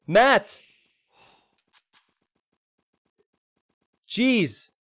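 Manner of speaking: high, stunned exclamations; overwhelmed, calling out; excited. Real, not acted. Reacting to a big gift.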